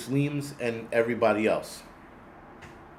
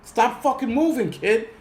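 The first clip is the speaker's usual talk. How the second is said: exasperated; 10 semitones higher, 3 dB louder, slower than usual. Retold or imitated. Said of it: "And I'm like".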